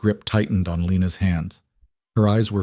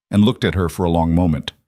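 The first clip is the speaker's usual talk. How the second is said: plain reading voice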